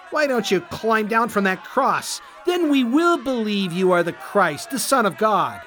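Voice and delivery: sneering taunt. Acted. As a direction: taunt him to climb down. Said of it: "they sneered".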